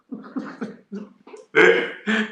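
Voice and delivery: big burst of laughter; loud laugh. Real, not acted. Laughing at his own joke.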